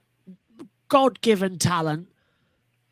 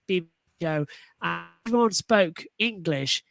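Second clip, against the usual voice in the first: ordinary talk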